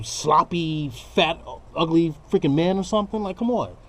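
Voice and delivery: ordinary talk, nothing marked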